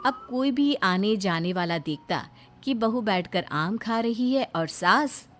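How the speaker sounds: story narration. A narrator's reading. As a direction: tell the story calmly. Narrator links scenes.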